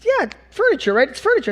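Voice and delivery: high, insistent buyer voice; a customer pushing the point; eager buyer. Retold or imitated. Art show visitor insisting it is furniture, high voice.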